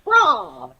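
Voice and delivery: high dinosaur cry; pterodactyl screech. Retold or imitated. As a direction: shriek like a pterodactyl. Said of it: "Or a pterodactyl".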